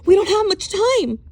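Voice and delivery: high, loud urgent plea; urging. Acted. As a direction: push him to hurry. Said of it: "urged Catherine".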